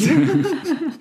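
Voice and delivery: a sudden high laugh at his own joke; high burst of laughter. Real, not acted. Joke about answering coffee machine.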